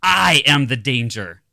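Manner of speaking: slow, loud menacing boast; Heisenberg. Pretend. Gardener posing as Heisenberg, slow loud threat. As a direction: hammer each word slowly. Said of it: "I put on a Heisenberg hat".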